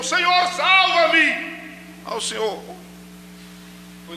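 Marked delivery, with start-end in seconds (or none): 0.0-1.2 high, slow cry to be saved; Pedro's desperate shout. Acted. Peter sinking, calling to the Lord, high drawn-out shout. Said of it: "ele gritou".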